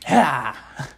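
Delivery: loud sung-out "ha" to finish; punchy "ha". Shown as a demonstration. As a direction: cap the jingle with a loud shout. Button ending the theme song.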